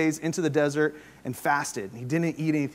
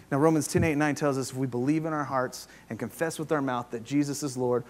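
usual speaking voice

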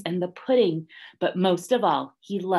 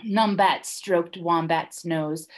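ordinary delivery

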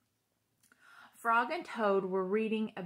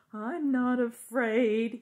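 shouted; 3 semitones higher, 7 dB louder, slower than usual. A narrator's reading. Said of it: "he shouted".

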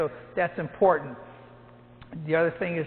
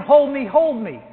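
retold yell; 7 semitones higher, 7 dB louder, slower than usual. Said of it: "She was yelling".

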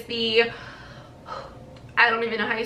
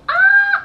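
very high, louder joyful squeal; happy squeal; 7 dB louder than usual. Real, not acted. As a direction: burst out with glee. Movie night with Jarvis tonight.

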